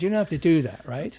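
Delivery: loud, high insistence; emphatic. Retold or imitated. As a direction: press the point hard. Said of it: "So I said".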